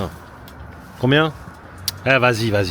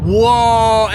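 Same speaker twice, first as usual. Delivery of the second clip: louder, higher wow of delight; delighted louder exclamation; amazed wow; 7 semitones higher, 6 dB louder than usual. Real, not acted. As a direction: greet the sunshine with a joyful wow. Sun appears after fog.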